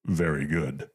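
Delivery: low, measured Vader imitation; Darth Vader voice. Costumed Vader answering the clerk, low Vader voice. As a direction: clip it like the Dark Lord. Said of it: "Darth Vader voice goes".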